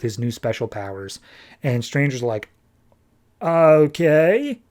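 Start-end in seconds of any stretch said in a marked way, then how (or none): 3.4-4.6 drawn-out, rising, baffled okay; mock-puzzled reaction; dubious okay. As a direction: stretch it out doubtfully, pitch climbing. Stranger baffled by Chris's new power.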